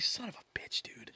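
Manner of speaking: hissed defeated curse; exasperated whisper. Retold or imitated. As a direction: whisper it like you've lost again. Mock exasperated reaction.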